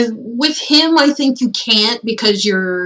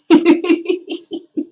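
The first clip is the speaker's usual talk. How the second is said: high laugh; 9 semitones higher than usual. Real, not acted. Laugh at stream chat.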